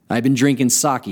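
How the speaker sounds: usual speaking voice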